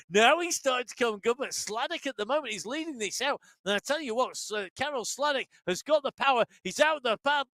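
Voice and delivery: high-pitched, excited race call; live sprint-finish commentary. Real, not acted.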